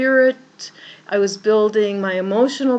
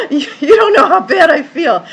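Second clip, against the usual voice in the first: crying protest; 8 semitones higher, 8 dB louder than usual. Retold or imitated. Protesting to the cleaning woman.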